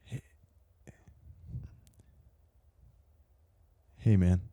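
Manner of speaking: timid, halting grim reaper; nervous Death voice. Pretend. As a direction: stammer it out like a shy Death. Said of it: "a really nervous death on his first day".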